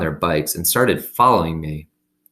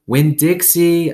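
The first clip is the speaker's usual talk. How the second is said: calling the dog; 11 semitones higher, 7 dB louder, slower than usual. A narrator's reading.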